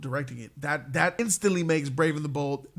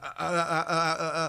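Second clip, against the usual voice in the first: mock stammering hesitation; string of stuck uhs, imitating a flustered dodge; imitated hemming. Director dodging a question about the film, mock stammering. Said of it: "He was like".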